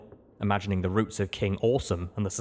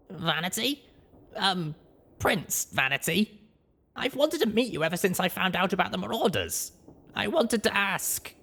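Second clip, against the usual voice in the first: higher, timid halting voice; hesitant young mare; 11 semitones higher than usual. Acted. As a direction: approach the hero shyly. Blackjack addresses the still ghoul.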